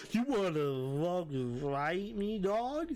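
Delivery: high, slow mock-drunk challenge; exaggerated drunk voice, swooping up high at the end; drunk person. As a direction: imitate a drunk slurring for a fight. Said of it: "And drunk people, being drunk people".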